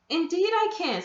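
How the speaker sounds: put-on small boy forcing bravery; trying to sound brave. A narrator's reading. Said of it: "trying to feel big and brave".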